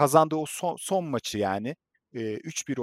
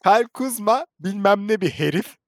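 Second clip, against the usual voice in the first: after laughing hard; 7 semitones higher, 4 dB louder than usual. Real, not acted. Güldükten sonra coşkulu anlatım.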